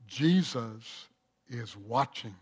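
low, soft eerie call; spooky voice in the dark. Acted. Mysterious voice calling to the thief in the dark.

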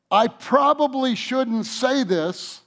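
hammed-up high, slow tattle voice; mock gossip. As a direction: mimic someone about to spill a secret. Mocking a gossip opener.

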